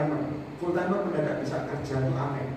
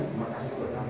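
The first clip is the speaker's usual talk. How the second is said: whispered shy prayer; 8 semitones lower, 7 dB quieter than usual. Retold or imitated. Shy quiet prayer before eating.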